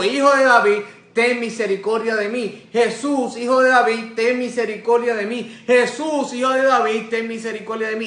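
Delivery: high, loud repeated cries; insistent shouting. Retold or imitated. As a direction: shout the plea over and over, urgently. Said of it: "dio aún más voces y le dijo".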